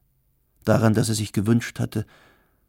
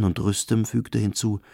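ordinary delivery, nothing marked

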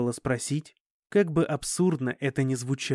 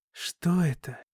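barely able to speak; 3 semitones higher, slower than usual. A narrator's reading. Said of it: "еле смог выговорить я".

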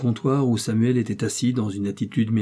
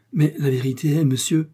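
higher-pitched, mildly flustered excuse; flustered stammering; 5 semitones higher, 4 dB louder than usual. A narrator's reading. Timid innkeeper excusing himself to fierce baronet, flustered. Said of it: "ajouta-t-il en balbutiant".

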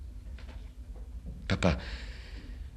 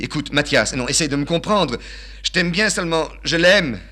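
put-on raised, pleading voice of a girl; girl begging her suitor to understand, higher and louder; young woman pleading; 11 semitones higher, 9 dB louder than usual. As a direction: plead with him, voice climbing high. Eurydice pleading with Mathias.

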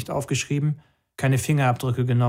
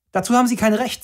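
put-on much higher, louder young voice; young protester defying the commander; defiant protest; 10 semitones higher, 4 dB louder, faster than usual. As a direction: refuse him boldly. Young man defies commander.

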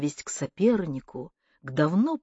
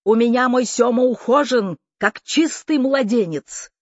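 put-on loud, high cry of an old aunt; shouting; 5 semitones higher, 7 dB louder than usual. A narrator's reading. Deaf aunt to arriving nephew, shouted. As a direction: call it out loud and high-pitched. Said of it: "крикнула тётя Лида".

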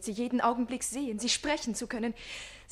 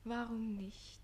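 normal talking voice

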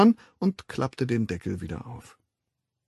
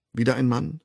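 usual speaking voice

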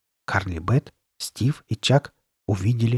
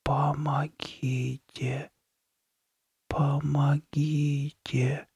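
eerie, drawn-out hushed plea; barely audible whisper; 4 semitones higher, 8 dB quieter, much slower than usual. A narrator's reading. A plaster head begging the three children for help, barely audible whisper. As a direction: whisper it faintly, very slowly, on one held note. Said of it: "еле слышно прошептала".

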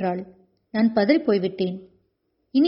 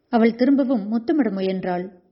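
usual speaking voice

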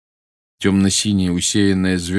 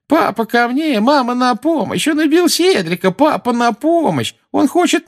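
mock cries for help; 5 dB louder than usual. Dudley mock screaming for help.

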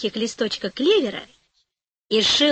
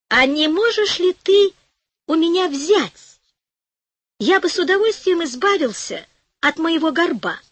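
higher, timid asking voice; hopeful shoemaker; 6 semitones higher than usual. A narrator's reading. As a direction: ask shyly, half hoping. Shoemaker asks fairy.

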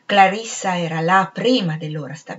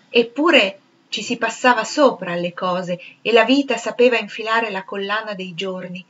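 plain reading voice

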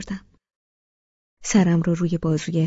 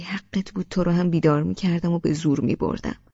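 ordinary delivery

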